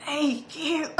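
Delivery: high, loud rasp, words barely coming out; imitating a raspy voice that won't come out. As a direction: croak it out like a ninety-seven-year-old smoker. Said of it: "my voice was so raspy yesterday".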